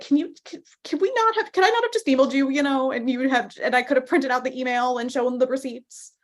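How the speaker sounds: high, pleading exasperation; exasperated. Retold or imitated. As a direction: plead in disbelief, fed up. Acted plea to venue management.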